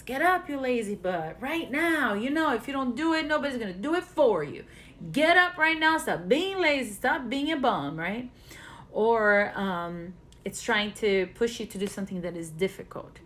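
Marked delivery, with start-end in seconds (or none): 0.0-8.0 higher, louder, fast scolding yell; harsh inner critic. Retold or imitated. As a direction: bark at yourself to get out of bed. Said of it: "your criticism voice says".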